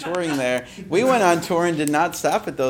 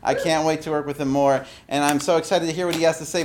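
ordinary talk, nothing marked

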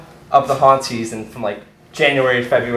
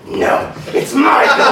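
high, slow shouted correction; forceful insistence; 10 semitones higher, slower than usual. Retold or imitated. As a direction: bellow it with menacing glee, stretching the name. Insists harder on the name.

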